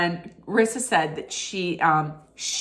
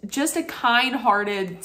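ordinary talk, nothing marked

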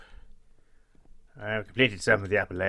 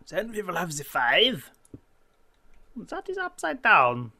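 put-on drawn-out, high German-accented voice; mock German accent; 6 semitones higher, 4 dB louder, slower than usual. Player counting blocks to themselves in a silly German accent. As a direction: put on a bad German accent, stretching the words. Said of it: "Why am I doing that accent?".

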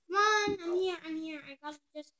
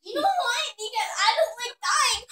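high, loud whiny protest; mad complaint; 6 semitones higher, 10 dB louder than usual. Real, not acted. Little sister protesting to her sibling about dying, mad. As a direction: complain loudly and laughing. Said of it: "I'm mad".

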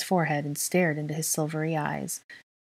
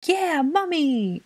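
high, loud, drawn-out cheer; excited kid voice, way up high; excited little child; 12 semitones higher, 5 dB louder, much slower than usual. A narrator's reading. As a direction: squeal it slowly like a thrilled small child. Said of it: "Aurelian said excitedly".

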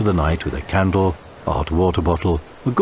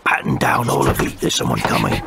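lower, urgent pirate warning; hushing pirate; 7 semitones lower than usual. Acted. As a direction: hush the gang in a low voice. Pirate hushes the gang.

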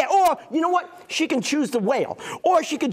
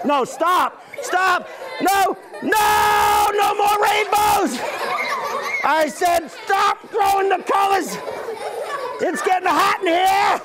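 mock protest; 6 semitones higher than usual. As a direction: pretend to be overwhelmed. Mock pleading during rainbow throwing.

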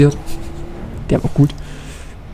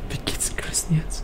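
whisper; 13 dB quieter than usual. Real, not acted. Aside after the crew survives.

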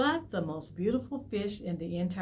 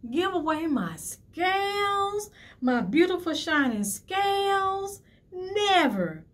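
high, loud, slow outrage; horrified refusal; 10 semitones higher, 6 dB louder than usual. Acted. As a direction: clutch what's yours. Fish refusing octopus's advice.

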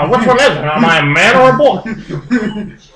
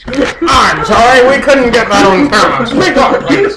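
calling out; 5 semitones higher, 3 dB louder than usual. Acted. Asks quiet speaker to speak up.

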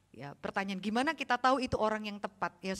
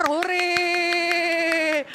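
put-on higher, louder kids' cheer; mock cheer; 7 semitones higher, 9 dB louder than usual. Children cheering that the preacher leaves, gleeful hurray. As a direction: celebrate like a happy child.

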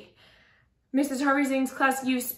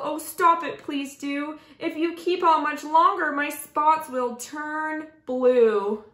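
higher-pitched storybook pleading; acted yelled protest; 6 semitones higher, 4 dB louder than usual. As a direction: protest as the giraffe, drawing out the last words. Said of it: "yelled Cardwell".

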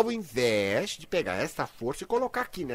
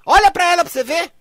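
excited insistence; 13 semitones higher, 12 dB louder than usual. Real, not acted. Host excitedly insisting to caller.

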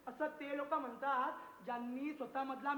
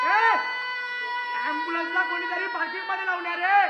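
loud, fast and higher; yelling; 4 semitones higher, 11 dB louder, faster than usual. Acted. Director yells at the crew to move the ambulance, loud and fast. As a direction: bark the order across the stage.

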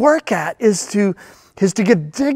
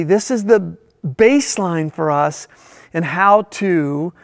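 normal talking voice

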